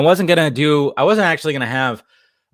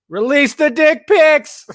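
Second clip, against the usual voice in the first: mock announcer; 6 dB louder, slower than usual. Hammy chant to chat.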